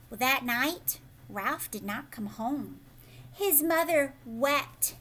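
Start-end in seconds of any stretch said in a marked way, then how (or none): none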